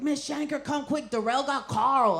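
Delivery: put-on high, urgent kid voices; kids calling for help. Students rushing in to fetch teacher, urgent.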